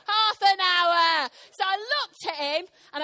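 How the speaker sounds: loud, indignant shout with drawn-out words; retold anger, hammed-up and slow; angry outburst. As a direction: stretch out HALF AN HOUR in outrage. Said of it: "Because I was angry".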